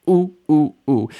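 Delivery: high, loud mock "ooh" on each step; bumping down stairs. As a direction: pretend to bounce down the stairs in oohs. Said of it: "falling down the stairs on your butt".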